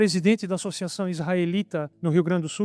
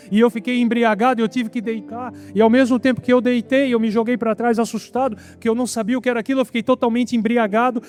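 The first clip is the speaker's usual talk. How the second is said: high, forceful testimony at full flow; pregação exaltada; 5 semitones higher, 4 dB louder than usual. Real, not acted. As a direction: preach the climax, pitched up and driving. Preacher at heightened testimony climax. (testemunho exaltado, voz aguda e intensa)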